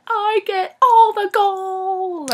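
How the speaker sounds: high, loud, drawn-out bragging; hammed-up excited boast, stretched out slow; over-the-top boast. Acted boast before she started laughing.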